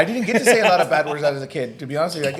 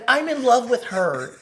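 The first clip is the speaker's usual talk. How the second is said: higher slow mock mom voice; dreamy quoted mom; imitating mom; 8 semitones higher than usual. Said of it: "She's like".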